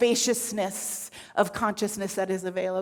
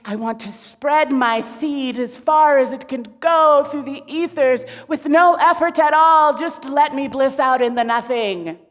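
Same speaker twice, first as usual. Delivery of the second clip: loud, higher-pitched declaiming; grand proclamation; 4 semitones higher, 6 dB louder than usual. Acted. Shiva demanding to bliss out, loud proclaiming. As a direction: proclaim it far and wide.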